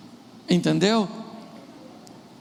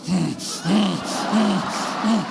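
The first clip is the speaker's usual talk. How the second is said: short grunted hums with gaps between; acting the spouse who grudgingly grunts along; sulky grunts; slower than usual. Sulking spouse during the couple's prayer, grudging grunts. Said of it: "E o outro fica".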